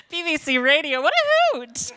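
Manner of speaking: high, loud hammed-up delight; mock posh exclamation. Mocking BBC Radio to the audience, hammed-up high exclamation. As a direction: trill it like a mock-posh lady.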